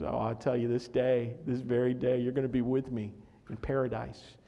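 low, soft promise; gentle reassurance. Retold or imitated. Jesus promises the thief paradise, gentle reassurance. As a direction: voice Jesus quietly reassuring someone.

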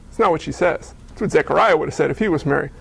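ordinary delivery

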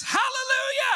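very high, frantic acted yell; hammed-up panicked cry; desperate shout. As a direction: scream it to stop a runaway horse. Rider's desperate stop at cliff edge.

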